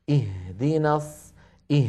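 breath flowing on the ha of "إه"; "إه" with an audible lengthened h; sounded, held ha. Shown as a demonstration. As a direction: sustain the h in "اهدنا" before going on. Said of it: "لاحظوا صوت الهاء".

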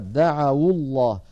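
slow "دعوا الله" linked as "da'awullah"; damma on waw. Shown as a demonstration. Teacher showing the result, "دعوا الله" slowly joined with damma. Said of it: "حركت الواو بالضم".

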